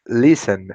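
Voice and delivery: "listen" with LIS hit hard; stress on first syllable. Shown as a demonstration. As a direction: punch the opening of "listen". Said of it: "o início da palavra que for a parte mais forte".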